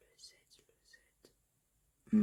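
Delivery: slow whispered search. Real, not acted. Hunting for a letter, whispering to herself. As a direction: whisper it to yourself while looking.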